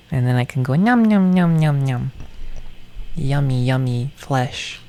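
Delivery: sing-song little monster relishing flesh; cutesy monster gobbling. Pretend. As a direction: play a tiny harmless creature savoring its meal. Cute-monster eating fantasy.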